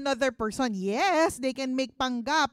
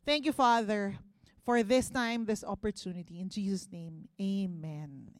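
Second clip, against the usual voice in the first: quiet, reverent prayer ending softly; closing prayer; 4 semitones lower, 5 dB quieter than usual. Acted. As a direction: hand the service over to God, hushed.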